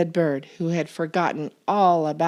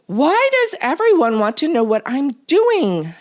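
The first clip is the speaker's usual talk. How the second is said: loud, higher exasperated shout; 8 semitones higher, 7 dB louder than usual. A narrator's reading. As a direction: yell it, finally losing patience. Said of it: "shouted Bird".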